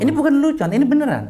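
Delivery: high, loud, emphatic protest; real insistence. Real, not acted. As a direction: drive it home over the laughter. Repeats that it is no joke.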